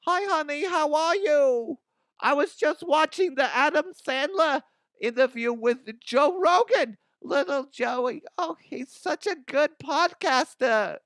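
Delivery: high sweet motherly voice; mama voice. Acted. Mama the Huff chatting to her honey, in a high sweet mama voice. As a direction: coo like a doting mom. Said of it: "I have to get in the mama voice".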